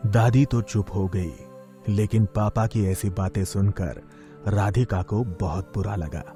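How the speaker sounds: usual speaking voice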